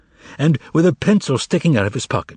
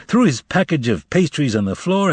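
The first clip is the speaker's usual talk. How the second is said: ordinary delivery, nothing marked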